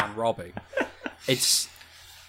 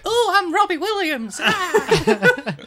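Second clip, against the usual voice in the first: high, laughing silly Robbie voice; [name] impression; much slower than usual. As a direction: squeak it out mid-laugh. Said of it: "Do a [name] impression".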